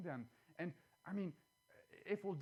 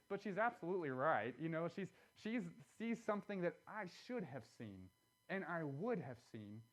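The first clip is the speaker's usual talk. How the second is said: ordinary talk, nothing marked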